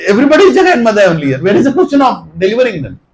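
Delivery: ordinary delivery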